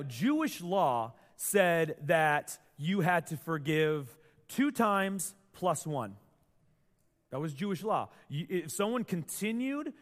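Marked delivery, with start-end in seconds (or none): 0.0-6.2 slow emphasis. Shown as a demonstration. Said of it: "slow emphatic statement".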